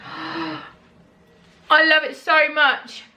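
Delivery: high, very slow adoring sigh; swooning delight. Real, not acted. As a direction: melt over it. Drawn-out adoring gasp.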